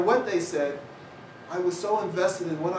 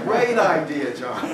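laughing; 8 dB louder, slower than usual. Retold or imitated. Laughing mockery of town.

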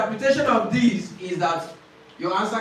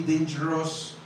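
ordinary talk, nothing marked

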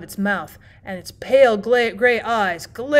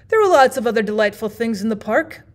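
ordinary delivery

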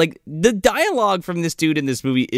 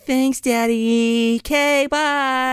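high, very drawn-out girlish imitation; mock sweet daughter; 9 semitones higher, much slower than usual. Daughter says goodbye to Daddy, mock sweet. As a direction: stretch it out sing-song, pitched way up. Daughter voiced answering phoney-nice dad.

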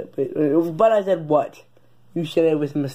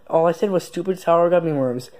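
ordinary talk, nothing marked

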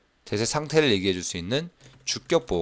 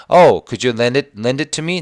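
slow, louder asking read; slow read-aloud; 3 semitones higher, 6 dB louder, much slower than usual. Shown as a demonstration. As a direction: model the request slowly. Teacher reads dialogue line. (천천히 부탁하듯 읽기)

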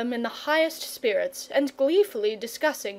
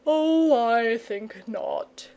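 slow, lazy dragon drawl; much slower than usual. Acted. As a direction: wave it away idly. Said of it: "said the dragon in his lazy way".

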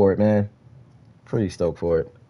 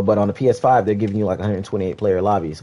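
ordinary talk

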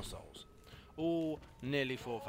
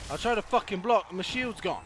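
loud, higher complaining shout; angry outburst; 7 semitones higher, 10 dB louder than usual. Real, not acted. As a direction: rant at the game. Player angry at losing shield.

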